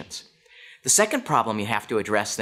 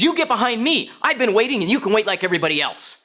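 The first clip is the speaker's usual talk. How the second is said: high-pitched scolding, acting the angry man; indignant scolding; 7 semitones higher than usual. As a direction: tell her off, pitched up and indignant. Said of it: "he yells one more time".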